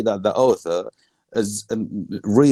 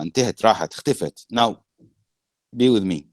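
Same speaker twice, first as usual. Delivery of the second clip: ordinary delivery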